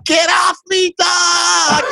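high-pitched mock outburst; mock protesting shout. Friend fending off nagging co-host, mock protesting shout. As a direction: yell it high, playing harassed.

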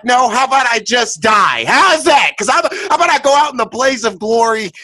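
high, loud defiant shout. Retold or imitated. Refusing the friend's chip advice with a high, loud shout. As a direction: shout it back, refusing outright. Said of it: "I was like".

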